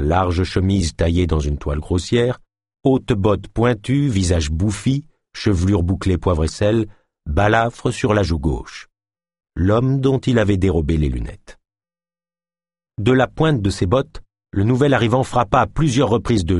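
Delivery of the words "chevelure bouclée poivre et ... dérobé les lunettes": plain reading voice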